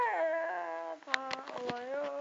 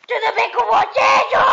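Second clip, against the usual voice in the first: high, loud mocking shout; panicking gamer; 7 semitones higher, 14 dB louder than usual. Real, not acted. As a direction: yell the taunt at the rival. Mocking the opponent mid-fight.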